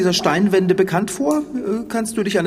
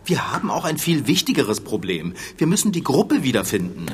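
ordinary talk

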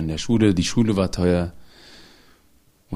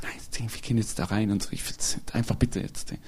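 leise, fast tonlos gezischt; gepresstes Flüstern; 9 dB quieter than usual. Retold or imitated. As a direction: der Mutter nachgemacht, beschämt und flüsternd. Mutter flüstert vor der Bank. (beschämt geflüstert, damit keiner es hört)

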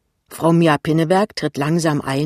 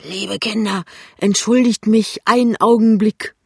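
tipsy intruder, getting louder; 7 semitones higher, 6 dB louder than usual. A narrator's reading. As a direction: excuse yourself with boozy ceremony. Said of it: "dass der Besitzer beschickert ist".

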